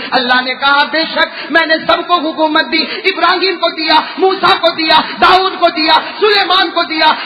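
loud, rhythmic enumerating sermon; full-voiced preaching. Preacher listing the Prophet's questions to Allah, full-voiced preaching. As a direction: drive each point out to the crowd.

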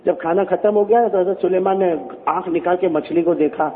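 ordinary talk, nothing marked

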